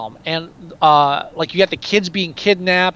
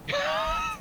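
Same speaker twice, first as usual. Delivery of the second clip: high squeaky laugh; 11 dB quieter than usual. Real, not acted. Laugh after the yell.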